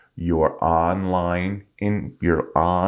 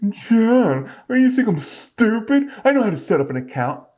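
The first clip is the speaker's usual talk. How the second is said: higher, offended voice of a know-it-all viewer; much higher mock-indignant comeback; indignant viewer retort; 12 semitones higher than usual. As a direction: act out a viewer insulted by the suggestion. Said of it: "And you go and watch it".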